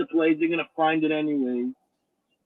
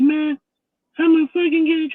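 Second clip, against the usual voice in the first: high imitation of a distraught man; mock crying; 11 semitones higher, 4 dB louder than usual. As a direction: wail it, mocking his tears. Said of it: "he comes to the T V room crying".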